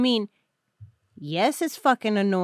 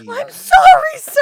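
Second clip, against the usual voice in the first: loud, high-pitched mock yell; shouted apology; 12 dB louder than usual. Stepping from behind the tree to a huge guy, shouting an apology. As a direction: blurt it out at the top of your voice.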